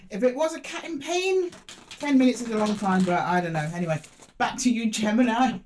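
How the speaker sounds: ordinary talk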